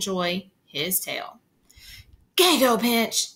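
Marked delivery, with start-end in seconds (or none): 2.4-3.3 loud, higher crow's outburst; crow's harsh croak. A narrator's reading. Fed-up crow startles the boy tormenting him, harsh croak. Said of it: "Terrified at the crow's harsh croak".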